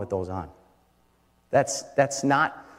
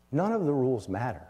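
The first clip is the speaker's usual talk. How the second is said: ordinary talk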